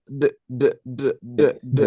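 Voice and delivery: "D" repeated in separate voiced taps; sound of D. Shown as a demonstration. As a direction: say "D" as a short voiced duh. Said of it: "la D sería".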